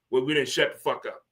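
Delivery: normal talking voice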